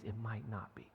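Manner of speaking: whispered, hushed aside. Shown as a demonstration. Pastor telling the congregation pain may not end, whispered. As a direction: drop to a whisper on the hard truth.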